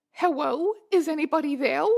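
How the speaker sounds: cutesy uwu voice turning l into w, hewwo; uwuified pleading. Acted. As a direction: plead in a cutesy baby-talk lisp. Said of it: "uwuified words".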